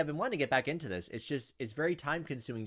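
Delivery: usual speaking voice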